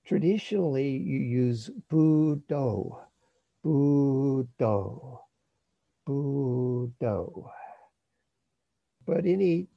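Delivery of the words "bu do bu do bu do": slow, drawn-out two-syllable chant; breath-paced mantra. Shown as a demonstration. Teacher showing the traditional meditation word, slowly breath-paced. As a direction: stretch each syllable over a breath, pausing between rounds. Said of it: "first syllable on the in breath, second syllable on the out breath".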